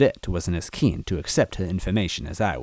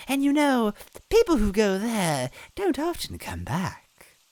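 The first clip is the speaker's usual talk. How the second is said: high sly witch voice; Cackletta, sly. A narrator's reading. Villain hinting about the brother, high sly witch voice. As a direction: tease them with a cruel secret.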